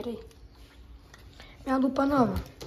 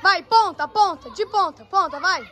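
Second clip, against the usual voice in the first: yelling from the sidelines; 3 semitones higher, 3 dB louder than usual. Real, not acted. Cheering divers at pool.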